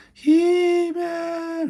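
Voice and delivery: high, drawn-out ghostly call of his name; eerie calling voice. Retold or imitated. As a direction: call him from the beyond, high and long. Sorceress calls from Nether Realm.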